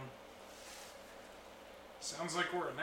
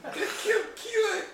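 mock outrage; 10 dB louder than usual.

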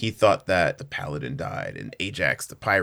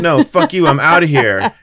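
loud, raised mock outburst; retold angry refusal; 5 semitones higher, 11 dB louder than usual. Pirate quitting the plan, mock angry refusal. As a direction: blurt it out with a laugh in it.

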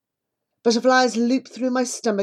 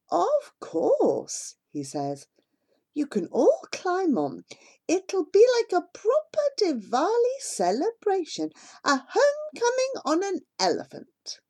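higher, friendly villager voice; lightly voiced mahout; 12 semitones higher than usual. A narrator's reading. Mahout welcoming the family back, light high voice. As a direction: greet returning neighbours warmly.

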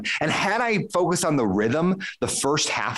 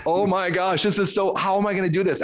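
retold dread; 6 semitones higher than usual.

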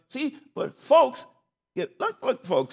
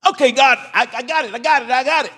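mock surrender; 8 semitones higher, 8 dB louder than usual. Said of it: "God's kinda like".

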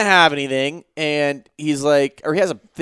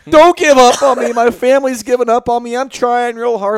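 high-pitched imitation of a tearful whine; mock crying plea; 6 semitones higher, 5 dB louder than usual. Intruder complaining his family is giving up, mock tearful. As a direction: plead pitifully, hamming up the self-pity. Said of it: "he starts crying".

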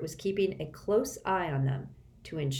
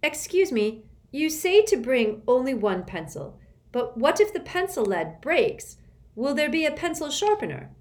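put-on polite child; 7 semitones higher, 5 dB louder than usual. A narrator's reading. Child asks test woman.

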